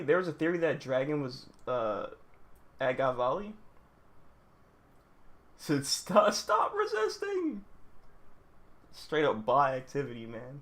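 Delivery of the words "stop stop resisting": very high, slow mocking imitation; mock officer. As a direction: mimic the order in a drawn-out squeal. Mimics officer's order to captives.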